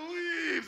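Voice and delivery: high wailing laugh-shout into leaves; anguished cry. Retold or imitated. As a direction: howl it in despair. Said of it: "You're just like".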